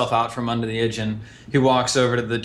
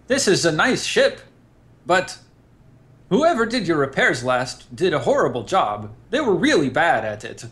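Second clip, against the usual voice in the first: high, chatty tactless critique; Eric character voice; 6 semitones higher than usual. Acted. Eric to the crew about the old mechanic, high tactless chatter. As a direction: blurt out the criticism cheerfully. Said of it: "Eric critiques the ship".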